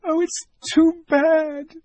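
put-on very high, slow sobbing girl; a child's tearful lament. A narrator's reading. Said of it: "sobbed Lucy".

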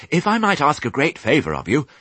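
high, polite, slightly flustered request; eager little pig, politely rushed; hurriedly. A narrator's reading. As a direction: ask politely, in a bit of a hurry. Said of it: "he said hurriedly".

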